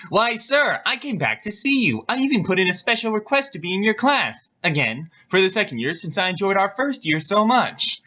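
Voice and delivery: high-pitched, pretend-wounded student; mock hurt. Student feigning hurt to his professor, mock hurt. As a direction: play hurt innocence, pitched well up. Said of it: "Reno pretended to look hurt".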